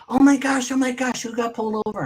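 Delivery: high-pitched panicked imitation; freaking out. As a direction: mimic a woman flipping her lid, voice shooting way up. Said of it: "She was freaking out".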